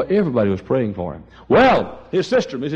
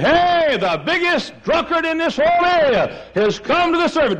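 amazed exclamation; 14 semitones higher than usual. Retold or imitated. Congregation amazed at the drunkard.

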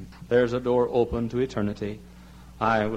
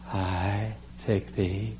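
very slow, soft, low vow ending in a sigh; sighing bride; 4 semitones lower, 4 dB quieter, much slower than usual. Retold or imitated.

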